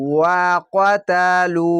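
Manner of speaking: ওয়াকাতালু slow, long vowels held; Quranic recitation. Shown as a demonstration. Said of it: "এক আলিফ টেনে পড়বেন".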